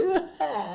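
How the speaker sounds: short high-pitched burst of laughter. Real, not acted. Recalling people calling his voice annoying, high laugh.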